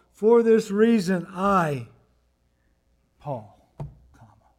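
loud, slow start, then "Paul," and a dropped-away "comma"; emphatic reading with pause. Retold or imitated. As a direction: build on "For this reason", then pause.

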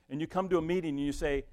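ordinary talk

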